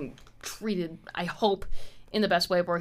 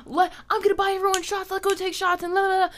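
high, fast, giddy party voice; mock party hype; 11 semitones higher, 3 dB louder, faster than usual. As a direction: squeal it like an overexcited friend. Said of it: "if you're like".

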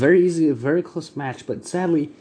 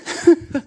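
sudden high evil cackle; witch cackle; 4 dB louder than usual. Acted. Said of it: "She lets".

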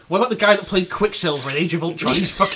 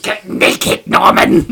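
goblin order; 3 semitones higher, 10 dB louder, much slower than usual. Retold or imitated. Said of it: "goblin voice".

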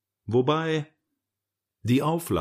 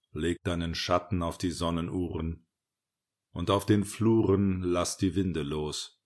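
ernste, getragene Rilke-Rezitation; Gedichtvortrag; 4 semitones lower than usual. Acted. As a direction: trag das Herbstgedicht ruhig und gesammelt vor, etwas tiefer. (ernster, getragener Gedichtvortrag)

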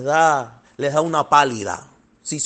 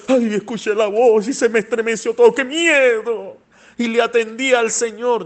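mock frightened believer; 5 semitones higher than usual.

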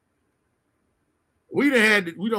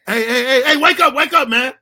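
loud, higher teasing wake-up call; mocking brother; 3 semitones higher, 8 dB louder than usual. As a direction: tease him awake, loudly. Brother waking him, mocking.

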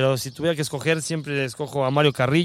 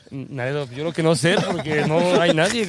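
ordinary delivery

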